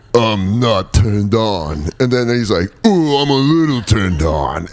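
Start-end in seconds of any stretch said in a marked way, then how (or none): 0.1-1.9 very slow mock-stoic denial; Rambo. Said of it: "where he's like". 2.8-4.7 higher, drawn-out mock arousal; Rambo. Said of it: "like a violence orgasm".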